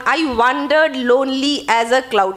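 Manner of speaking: very slow "I wandered lonely as a cloud" in alternating beats; weight every second syllable of "I wandered lonely as a cloud"; stressed-unstressed meter. Shown as a demonstration. Teacher showing iambic stress, "I wandered lonely as a cloud" beaten out slowly. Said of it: "stressed আনস্ট্রেসডের ব্যাপার রয়েছে".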